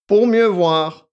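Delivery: loud, high, drawn-out answer; pompous and slow; self-important. A narrator's reading. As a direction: explain it grandly. Pompous reply to naive girl.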